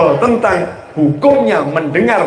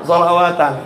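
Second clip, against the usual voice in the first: ordinary talk, nothing marked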